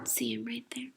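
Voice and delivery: soft unvoiced whisper. Real, not acted. Reader whispering an aside to the listener.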